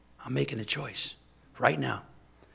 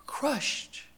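higher, pained repetition; grief; 9 semitones higher than usual. Real, not acted. Preacher repeating his grief over a lost son, pained. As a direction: ache on the word.

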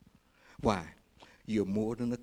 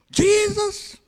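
very high, loud, drawn-out yell; full-throated cry of a beggar who won't be silenced; defiant crying out; 12 dB louder than usual. Acted. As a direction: holler the name to be heard over a crowd. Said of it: "when they told him to shut up".

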